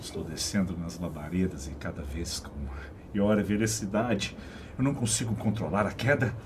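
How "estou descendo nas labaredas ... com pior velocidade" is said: ordinary delivery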